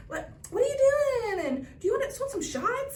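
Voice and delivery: high, imitated young guy's offer; cute kid. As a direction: imitate the kid, voice pitched well up. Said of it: "and he was like".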